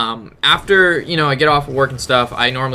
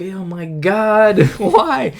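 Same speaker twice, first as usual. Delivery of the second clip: higher, drawn-out mock despair; exasperated wail; 5 semitones higher, much slower than usual. Storyteller being yelled at by the approaching man, exasperated wail. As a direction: wail it slowly, half laughing. Retelling his dismay at being followed.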